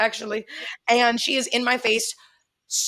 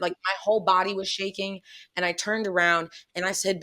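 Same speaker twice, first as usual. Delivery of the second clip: usual speaking voice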